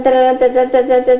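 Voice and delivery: normal talking voice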